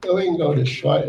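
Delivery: ordinary talk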